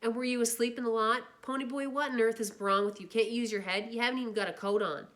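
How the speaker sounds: loud, exasperated lecture; angry scolding. Acted. Older brother scolding the boy who stayed out, angry. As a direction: tear into him.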